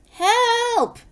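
high, loud drawn-out call; storybook distress shout; cry for help. A narrator's reading. Trapped characters calling for help.

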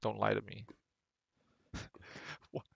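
ordinary talk, nothing marked

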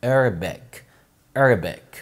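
"Arabic" as ER-bek, first vowel bent toward e; slightly snooty. Shown as a demonstration. Teacher drilling mothers, "Arabic" as snooty ER-bek. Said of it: "في تناكة كده في الموضوع".

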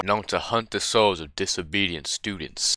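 low, hushed horror-trailer voice; dramatic narrator. Pretend. Gamer reading the game's warning text, spooky narrator voice. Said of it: "too early for me to be talking like this".

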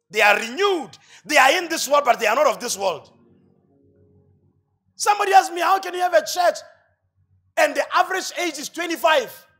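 shouted sermon climax; loud preaching. Real, not acted. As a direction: preach it out to the whole hall, full force. Preacher rising to full force.

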